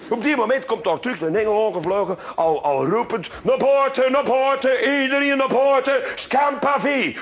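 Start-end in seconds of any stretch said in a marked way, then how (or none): 3.4-7.2 loud, high urgent call; the angel hurrying everyone outside. Acted. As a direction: rush everyone out. Angel calling out.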